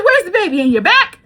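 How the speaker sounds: high, loud laughing astonishment; imitating the manager's amazed laugh; surprised. Manager noticing the bump, laughing surprise.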